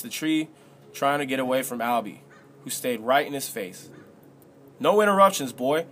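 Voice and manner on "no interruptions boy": higher, louder angry outburst; raised-voice order cutting someone off; shouted. A narrator's reading. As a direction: shout him down. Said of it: "Albie shouted".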